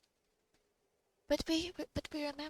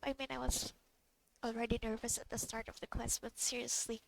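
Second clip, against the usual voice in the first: normal talking voice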